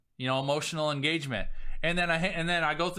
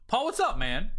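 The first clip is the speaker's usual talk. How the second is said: loose and friendly, pitched up and louder; casual buddy greeting; 7 semitones higher, 4 dB louder than usual. Shown as a demonstration. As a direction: greet him like an old pal. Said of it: "that's literally how I will text you".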